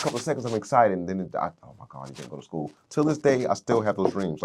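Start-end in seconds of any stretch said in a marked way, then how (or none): 1.6-2.7 low, soft, deflated self-talk; quietly crestfallen, retelling it; retold letdown. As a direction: let the voice sink as the dream fades. Waking from a college dream, let down.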